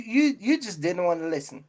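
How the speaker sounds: ordinary talk, nothing marked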